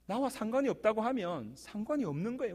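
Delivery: ordinary talk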